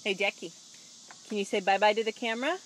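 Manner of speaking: usual speaking voice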